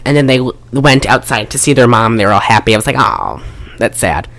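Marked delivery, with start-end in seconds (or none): 3.0-4.3 sad. Retold or imitated. Mock sad reaction.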